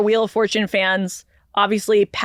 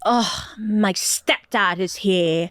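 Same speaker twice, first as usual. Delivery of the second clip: very slow, drawn-out announcing; Wheel of Fortune voice; much slower than usual. Acted. Wheel of Fortune greets stepdad, slow dramatic announcement. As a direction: stretch each word like a game show reveal. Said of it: "the Wheel of Fortune is like".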